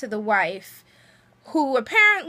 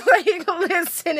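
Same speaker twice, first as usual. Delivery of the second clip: slow, louder listen through a laugh; laughing, amused; 6 semitones higher, 5 dB louder than usual. Real, not acted. Vlogger cracking up at the killer's line, laughing slowly. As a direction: laugh while stretching it out.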